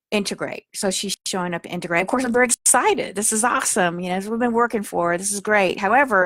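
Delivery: usual speaking voice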